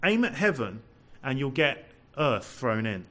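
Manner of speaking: ordinary talk, nothing marked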